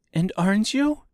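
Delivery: put-on high girl's question; girl character. A narrator's reading. Dorothy questioning the wizard, high girl voice. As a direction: ask up high as a young girl.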